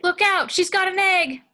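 high, loud panicked warning; alarmed shout. Acted. Bystander warning Mugatu about the egg, loud high panic. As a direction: yell the warning at full pitch.

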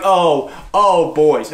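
low playful uh-oh voice; mock warning. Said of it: "And I'm like".

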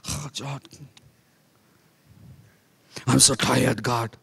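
mock weary groan. Tired believer moans to God at night, softly. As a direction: sag into it like an exhausted believer. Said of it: "It's like".

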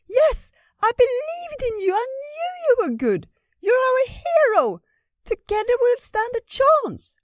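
very high, loud squirrel cheering; excited squirrel. Acted. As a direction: squeal it like an eager little squirrel.

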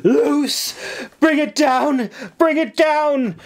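high, forceful battle cry; urgent commander's yell, pitched high; hoarse shouted command. A narrator's reading. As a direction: shout orders over the fighting. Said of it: "her voice grew hoarse as she shouted".

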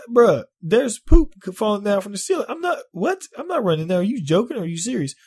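high disbelieving protest; incredulous refusal. Retold or imitated. Refusing his boss's order, high incredulous protest. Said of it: "I said".

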